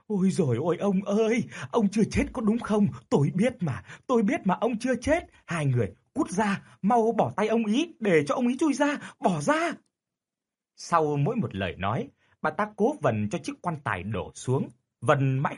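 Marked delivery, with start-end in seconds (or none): 0.1-9.7 wailing widow. A narrator's reading. Widow wails at her husband's coffin, high desperate cry. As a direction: wail in desperate grief at the coffin. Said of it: "miệng gào khóc".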